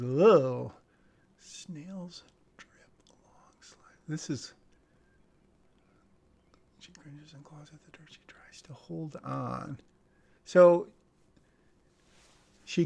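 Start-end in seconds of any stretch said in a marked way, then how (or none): 0.0-0.7 loud grossed-out groan; a real ugh of disgust at the worms. Real, not acted. Script reader groans in disgust at the worms. 1.4-9.8 whispered script read. Acted. Reader whispers the script's creepy action lines.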